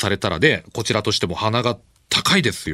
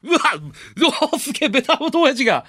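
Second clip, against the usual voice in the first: amazed; 13 semitones higher, faster than usual. Real, not acted. Amazed reaction.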